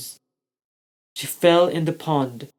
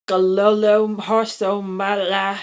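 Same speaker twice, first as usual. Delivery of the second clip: higher, louder nonsense babble; dragon babble; 5 semitones higher, 5 dB louder than usual. A narrator's reading. Baby dragon Rascal babbling a new word. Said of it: "Rascal said".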